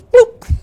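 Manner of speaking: high, loud bird chirp; mama eagle boop. Acted. Mother eagle tipping her chick out, high chirp. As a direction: pop it out like a bird call.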